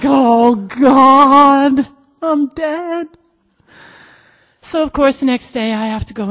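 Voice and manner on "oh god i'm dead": high, wailed comic despair; comic dread. Retold or imitated. Realizing she must steal the hair, dismayed. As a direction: wail it loudly, then drop the volume. Dreading her part in the plan.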